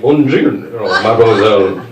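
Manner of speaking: put-on slow, suave French accent on mademoiselle. Comic greets the neighbor lady in a mock French accent. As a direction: charm her with a hammed-up French accent. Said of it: "I respond in a different accent".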